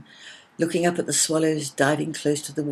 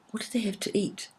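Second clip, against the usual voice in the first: eager whispered question; 7 semitones higher, 6 dB quieter than usual. A narrator's reading. Arwen whispers to Willow, eager to hear. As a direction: breathe it out, desperate to know.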